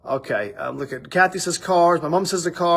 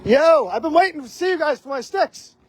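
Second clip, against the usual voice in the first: calling out; 9 semitones higher than usual. Real, not acted. Calling out to passersby.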